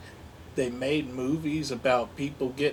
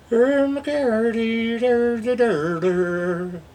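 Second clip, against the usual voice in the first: dopey meme voice; 7 semitones higher, much slower than usual. Pretend.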